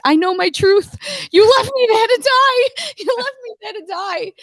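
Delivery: high, loud hammed-up rant; outraged, over-dramatic repeated protest; Vince Vaughn. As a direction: wail the accusation in mock betrayal. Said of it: "I'm, like, Vince Vaughning him".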